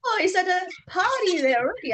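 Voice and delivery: high, lively voice acting out her surprise; mock excited gush. Recounting her reaction to the party, mock excited gush. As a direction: bubble it out like an excited guest. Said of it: "I was like, it's like".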